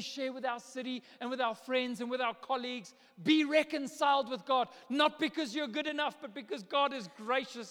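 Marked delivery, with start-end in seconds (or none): 3.2-7.7 preaching climax. Acted.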